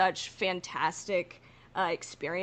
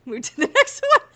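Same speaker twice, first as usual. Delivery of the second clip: high, loud laughing tease; teasing laugh; 10 dB louder than usual. Real, not acted. Cohost teasing the gushing host, laughing. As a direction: laugh while cutting her off.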